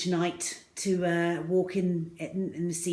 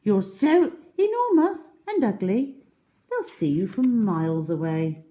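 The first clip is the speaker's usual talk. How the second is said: high, louder disgusted protest; high small crocodile; 5 semitones higher, 7 dB louder than usual. Acted. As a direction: insist it's revolting. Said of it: "said the not so big one".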